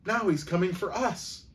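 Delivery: high, panicky voiced stage whisper; frightened stage whisper. A narrator's reading. Aunt tells grandmother the intruder approaches, frightened stage whisper. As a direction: squeak out the alarm, high and urgent. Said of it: "Aunt Gladys whispered".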